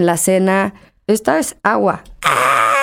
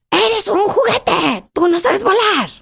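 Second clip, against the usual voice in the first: much higher and very slow, a cartoon quote flung out in anger; son's movie line; 14 semitones higher, slower than usual. Mother imitating her furious son quoting a movie, high and slow. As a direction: voice the angry boy quoting Monster Inc. high and drawn out. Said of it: "si él estaba enojado".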